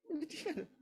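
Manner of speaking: soft, high mock-sad voice; a small, high, put-on pitiful voice; sedih pura-pura. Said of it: "sedih kan?". (lirih tinggi, pura-pura sedih)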